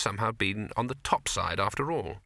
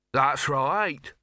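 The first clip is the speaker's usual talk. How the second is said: snapping; 3 semitones higher, 5 dB louder than usual. Acted. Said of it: "snapped a second woman".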